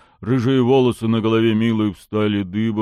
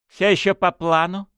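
mocking; 9 semitones higher than usual. A narrator's reading. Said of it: "насмешливо поинтересовалась ворона".

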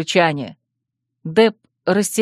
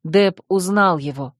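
ordinary delivery, nothing marked

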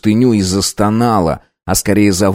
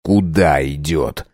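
wry grimace; 5 semitones lower, slower than usual. Acted. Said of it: "скривил губы".

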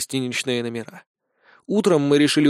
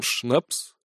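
puzzled; 2 semitones lower, 5 dB quieter than usual. A narrator's reading. Tavern keeper has never heard of schnapps, puzzled. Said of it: "удивился тавернье".

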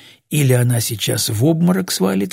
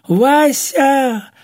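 high, loud drawn-out cry of his name; panicked shout; 13 semitones higher, 4 dB louder than usual. A narrator's reading. Old woman sees him reach into the box, shrieking. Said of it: "У Глафиры снова прорезался голос".

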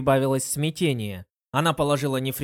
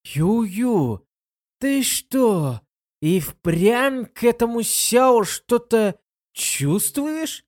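slow, every word hit separately; stress on every word; 10 semitones higher, 4 dB louder, much slower than usual. A narrator's reading. Lin Yueru presses her friend about Xiao, stressing every word. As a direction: punch each word slowly and deliberately. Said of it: "делая ударение на каждом слове".

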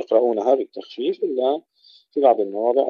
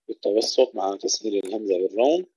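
ordinary talk

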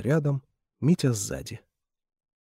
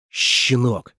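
hissed; 5 dB louder than usual. Acted. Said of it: "процедил сквозь зубы старший".

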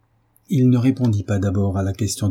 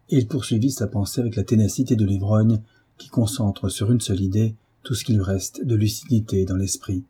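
ordinary delivery

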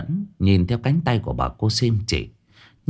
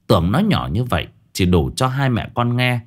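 ordinary delivery, nothing marked

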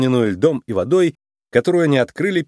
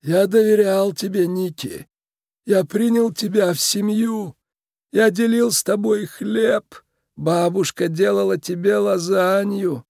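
creaky, wounded mob boss; 9 semitones higher than usual. Acted. Tiny boss reproaches Nick for broken trust, creaky and commanding. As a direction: lay out the betrayal in a measured creak.

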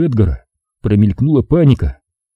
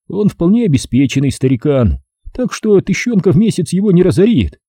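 put-on higher bitter young man voice; bitter young man, voiced higher; Edgar's voice; 7 semitones higher than usual.

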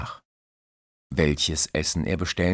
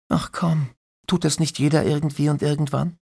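put-on breezy young woman; 11 semitones higher, 4 dB louder, faster than usual. Christine, breezy.

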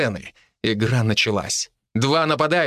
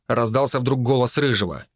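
ordinary delivery

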